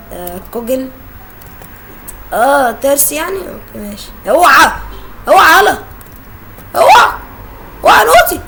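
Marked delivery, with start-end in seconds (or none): 4.3-8.4 loud frantic yells, one jumping much higher; panicked shouting. Real, not acted. Gamer chased by granny, yelling in panic. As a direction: shout in real panic to get away.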